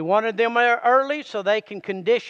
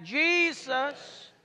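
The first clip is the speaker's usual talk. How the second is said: drawn-out, higher call of the name; leading a congregational response; 4 semitones higher, 5 dB quieter than usual. Real, not acted. Preacher leading everybody in saying it, drawn-out call. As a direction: stretch it out for everyone to join. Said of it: "Everybody say that".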